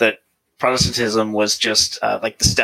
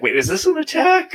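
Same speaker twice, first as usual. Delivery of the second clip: much higher-pitched retold bewilderment; startled disbelief. Speaker recalls feeling attacked by her points, startled disbelief. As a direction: question it in mock alarm, pitch leaping up.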